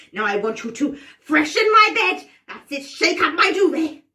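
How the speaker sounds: bossy witch. Pretend. Witch orders the players through her chores, bossy. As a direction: order them about with relish. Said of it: "I'm going to order you around".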